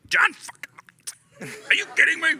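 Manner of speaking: high-pitched comic disbelief; hammed-up starstruck panic; incredulous. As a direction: squeal it as if stunned by the news. Retold disbelief at celebrity partner.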